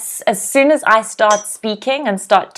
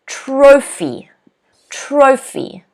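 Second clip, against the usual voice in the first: "trophy" with TRO stressed, then falling; teacher's model "trophy", hit the first syllable; 4 semitones higher, much slower than usual. Shown as a demonstration. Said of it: "the first syllable is stressed".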